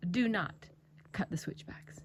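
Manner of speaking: whispered self-warning; hushed stern reminder. Real, not acted. Scolding self about switchbacks.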